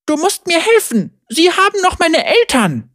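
put-on very high, urgent boy's voice; pleading child. Boy begging his rescuer for his parents, high urgent plea. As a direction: plead for help like a frightened kid.

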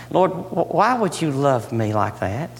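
ordinary delivery

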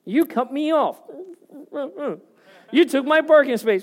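put-on high, petulant two-year-old whine; mock a toddler stomping its feet; whiny toddler. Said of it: "sounding like two-year-olds".

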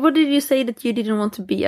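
ordinary talk, nothing marked

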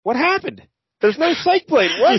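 very high-pitched, baffled exclamation; incredulous outburst. Real, not acted. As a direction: cry out in high-pitched disbelief. Baffled by a missing battle scene.

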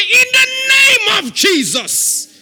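slow, loud, high authoritative command; shouted declaration of healing; commanding prayer. Shown as a demonstration. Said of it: "You say".

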